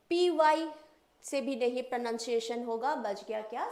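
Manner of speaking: usual speaking voice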